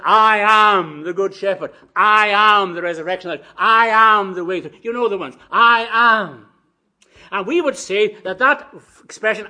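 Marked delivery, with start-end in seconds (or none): 0.0-4.7 preached proclamation. Acted. Preacher quotes Jesus' sayings to congregation, booming proclamation. 5.5-6.5 loud, high "I am"; preached proclamation. Acted. Preacher quotes Jesus to congregation, forceful proclamation.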